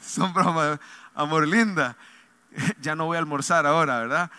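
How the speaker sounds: laughing. Real, not acted. Joking about mother-in-law.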